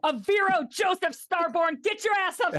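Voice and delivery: high, very loud scolding yell; mom voice. Acted. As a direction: holler the full name like an angry mother. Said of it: "That's mom voice".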